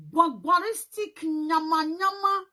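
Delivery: mocking gibberish; scornful nonsense word. Real, not acted. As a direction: spit out the nonsense with disdain.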